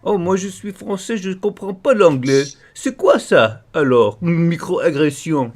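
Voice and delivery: high, put-on French accent, whiny and feigning confusion; mock French speaker. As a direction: play a francophone pretending not to understand English. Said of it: "this guy pulled this French thing".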